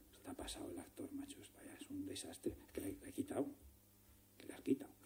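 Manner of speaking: muttered, nearly whispered exchange; hushed editing-room talk. Retold or imitated. Editor telling the director the take is overdone, whispered. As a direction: keep the argument under your breath.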